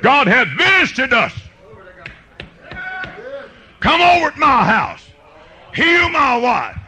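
high, impassioned revival preaching; fervent preaching. Real, not acted. Preacher testifying of his wife's healing, high fervent cries. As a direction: cry out the testimony with rising fervour.

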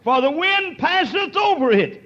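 high, loud scripture cry; preached scripture. Real, not acted.

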